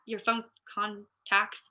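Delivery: "phone contacts" slowed right down, broken up; careful self-correction. Real, not acted. Speaker fixing a fumbled word, "phone contacts" said slowly. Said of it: "yep, can't talk".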